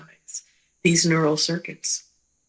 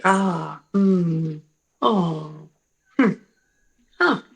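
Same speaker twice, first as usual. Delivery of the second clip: a string of wordless ah, mm, oh, hmm, huh sounds; vocal bursts; much slower than usual. Shown as a demonstration. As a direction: show each little burst of sound on its own. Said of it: "These are the non-language sounds".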